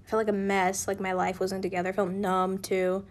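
ordinary delivery

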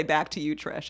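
low, soft throwaway sign-off; mock anchor sign-off. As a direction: toss it to Trish under your breath. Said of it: "I always wanna say".

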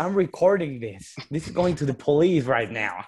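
slow, indignant American Karen; slow, threatening to call police. Acted. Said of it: "Súper racista la Karen".

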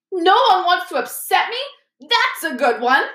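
high, bitter, wailing retort; howling Moaning Myrtle. A narrator's reading. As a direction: throw it back as an aggrieved howl. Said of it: "howled Myrtle".

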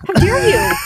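high, loud playful indignation; mock outrage. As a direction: scold in pretend shock, pitch leaping up. Mock scolding over unwatched episodes.

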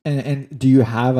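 normal talking voice

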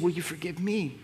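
hushed plea through tears, lightly voiced; tearful plea. Mother begging her son quietly for forgiveness, tearful. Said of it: "she began to cry".

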